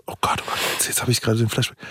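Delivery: hushed whisper. Real, not acted. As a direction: whisper it in shock. Sudden embarrassing flashback.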